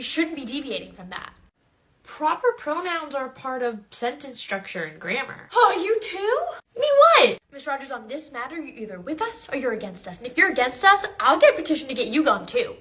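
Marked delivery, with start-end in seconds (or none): 5.5-7.4 indignant shock. Acted. Parent shocked teacher disagrees.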